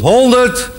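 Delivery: high-pitched shout from the back; sudden high holler; calling out. Acted. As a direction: shout it out like a heckler in the hall. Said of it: "roept er achter in de zaal iemand".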